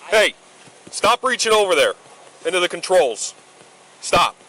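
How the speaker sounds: sharp loud shout, pitched high; shouting at trespasser. Real, not acted. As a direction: bark the order to stop him now. Officer yells at passenger reaching over.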